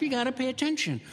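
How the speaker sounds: ordinary talk, nothing marked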